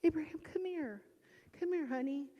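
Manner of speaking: high, gentle coaxing call. Retold or imitated. Mother coaxing her son to come, high and gentle. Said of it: "And I said".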